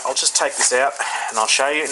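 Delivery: ordinary talk, nothing marked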